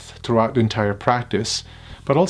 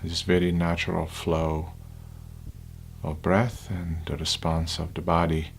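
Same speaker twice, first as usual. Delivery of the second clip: unhurried, hushed guidance, phrase by phrase; guided meditation; 6 semitones lower, 9 dB quieter than usual. Acted. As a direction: lead them gently into stillness, lower and quieter.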